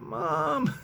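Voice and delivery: put-on high cracked "Mom!" as the kid; mock teen yell. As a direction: whine it up high like an embarrassed teen. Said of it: "he yells out".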